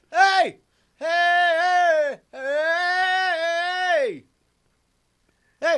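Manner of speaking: high, drawn-out hail; calling out. Acted. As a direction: holler to get a distant figure's attention. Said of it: "I repeat my call".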